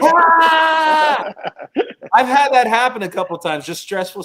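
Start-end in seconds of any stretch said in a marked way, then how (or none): 0.0-1.3 a full-throated release scream; car scream demo. Shown as a demonstration. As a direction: let it all out in one loud yell. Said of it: "make sure all doors are closed".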